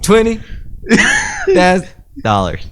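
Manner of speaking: high, loud, stretched smooth pitch; smooth crooning sales pitch. Pretend. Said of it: "you say it real smooth".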